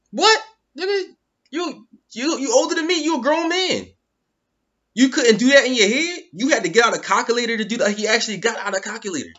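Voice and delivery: high, loud, disbelieving rant; can't believe what he just saw; incredulous outburst. Real, not acted. Mocking calculator use in disbelief.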